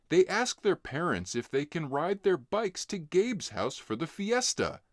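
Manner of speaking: plain reading voice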